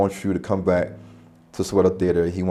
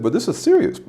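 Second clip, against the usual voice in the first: insistent protest; 12 semitones higher than usual. Retold or imitated. Insisting to amused theater manager.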